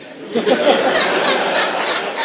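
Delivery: big burst of laughter; loud laugh. Real, not acted. Laughs after voice list.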